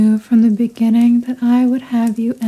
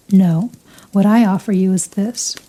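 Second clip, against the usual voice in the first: normal talking voice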